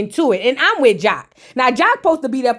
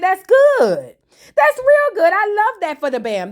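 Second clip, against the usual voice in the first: gushing approval; 9 semitones higher than usual. Retold or imitated. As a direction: squeal your delight for her. Cheering on the Bam's love.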